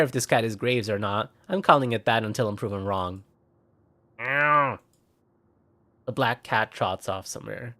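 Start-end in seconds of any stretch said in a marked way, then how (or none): 4.2-4.8 cat meow. Acted. Cat's meow voiced.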